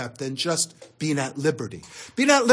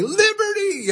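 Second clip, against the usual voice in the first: high ringing shout of "Liberty"; called-out announcement; 3 dB louder than usual. Retold or imitated. Ship's officer calling shore leave to sailors, high called shout. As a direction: call it out like a ship's loudspeaker.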